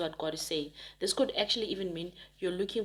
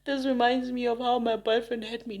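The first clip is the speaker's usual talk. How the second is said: mock teary voice; 4 semitones higher, 4 dB louder than usual. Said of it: "you're like".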